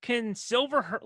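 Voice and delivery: usual speaking voice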